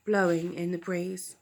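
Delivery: plain reading voice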